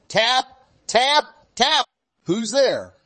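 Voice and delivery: put-on high little girl calling at the door; knocking and calling. A narrator's reading. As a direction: knock brightly, call in.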